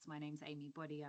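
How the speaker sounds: very soft introduction; faint, barely audible name; quiet voice. Real, not acted. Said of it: "You're really quiet".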